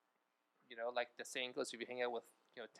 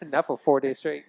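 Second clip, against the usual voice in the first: loud laughing reply; 3 semitones higher, 15 dB louder than usual. Real, not acted. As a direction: burst out laughing mid-sentence. Laughing while correcting.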